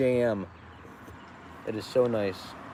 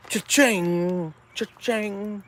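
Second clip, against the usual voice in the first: higher, playful cash-register cry; cha-ching; 9 semitones higher, much slower than usual. Acted. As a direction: sing out the sale sound. Celebrating sales.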